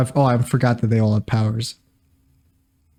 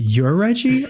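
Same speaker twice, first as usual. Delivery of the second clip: mock surprise; 8 semitones higher, slower than usual. Mocking the two Reggies.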